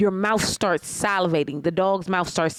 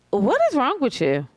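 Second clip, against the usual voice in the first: raised-pitch disbelief; indignant retort; 4 semitones higher than usual. Acted. Reacting to him refusing "I love you", indignant. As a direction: take him to task, incredulous.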